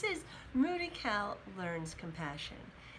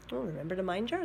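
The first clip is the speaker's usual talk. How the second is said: plain reading voice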